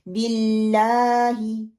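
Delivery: slow बिल्लाही with stretched LAA, short I; long laam vowel. Shown as a demonstration. Teacher modelling the two-count stretch in बिल्लाही, drawn out. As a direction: hold the AA of BILLAAHI for two counts. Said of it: "दो दफ़ा ही लंबा करना".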